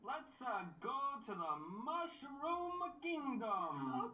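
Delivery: slow, high Brooklyn-tinged Mario voice; lightly put-on Brooklyn plumber voice; Chris Pratt Mario. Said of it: "Can you imitate it for us?".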